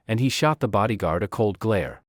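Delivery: usual speaking voice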